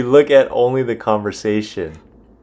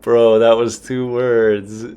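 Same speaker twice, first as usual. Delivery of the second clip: slow, loud, stretched-out reproach; exasperated mock callout; retold callout; 5 dB louder, much slower than usual. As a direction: drag out each word in mock complaint. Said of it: "and then I said".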